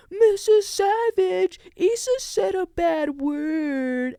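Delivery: put-on high, drawn-out kid calling out to the teacher; loud, slow, high-pitched imitation of a tattling classmate; mock tattletale. Classmate telling on her to Missus Savage, exaggerated tattling whine.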